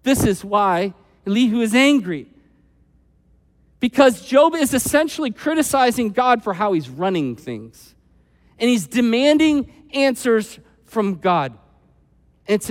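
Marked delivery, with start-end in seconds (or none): none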